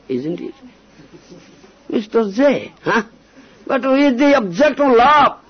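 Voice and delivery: ordinary talk, nothing marked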